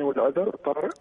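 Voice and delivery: ordinary talk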